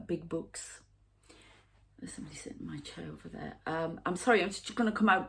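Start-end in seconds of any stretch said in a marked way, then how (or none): 2.0-3.6 fast, hushed whisper; rushed secret whisper, much softer; urgent whisper. Real, not acted. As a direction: whisper it quickly so the presence won't hear. Sensing a presence nearby.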